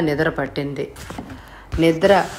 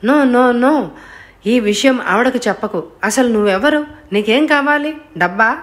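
panicked pleading; 6 semitones higher than usual. A narrator's reading. Mukundam panicking as the blackmailer threatens to tell his wife. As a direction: beg him not to tell, voice climbing high.

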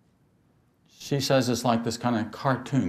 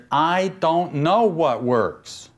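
slow surrender; 6 semitones higher, 4 dB louder, much slower than usual. Shown as a demonstration. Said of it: "You gotta say".

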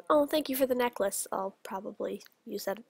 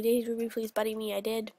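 ordinary talk

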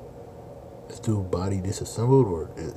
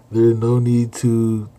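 louder and slow, overdone formal samurai reading; mock archaic samurai speech; 4 semitones higher, 8 dB louder, much slower than usual. Streamer forcing out samurai dialogue, slow and loud in mock grandeur. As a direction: drag out the old-fashioned words and push them loud. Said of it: "I do not like talking like this".

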